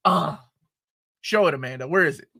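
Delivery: ordinary talk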